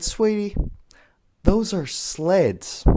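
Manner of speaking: high, very slow mock-patient teacher; imitated teacher. Teacher correcting her pupil about cars, slow imitated condescension. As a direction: draw it out as if explaining to a small child. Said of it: "And she said".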